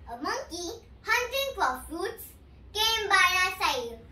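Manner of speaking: ordinary delivery, nothing marked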